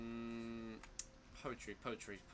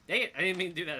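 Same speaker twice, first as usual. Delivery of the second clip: frustrated outburst; 4 semitones higher, 8 dB louder, faster than usual. Real, not acted. Frustrated at a misclick.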